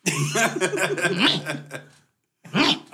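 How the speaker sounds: mock groaning monosyllables rising; imitating a dazed friend; groggy Ken. Ken groggy, retold in story.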